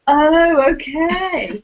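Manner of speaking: startled oh, deflated okay; mock surprise. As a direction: act out a puzzled discovery. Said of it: "the phone being like".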